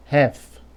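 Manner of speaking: "half" said as "hef", no L; silent L. Shown as a demonstration. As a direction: drop the L before F, "hef". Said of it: "Você vai falar".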